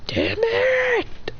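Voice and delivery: high, drawn-out mock curse; high-pitched exasperation without real force; acted frustration. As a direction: curse as a thwarted cartoon hero. Acted frustrated hero in story.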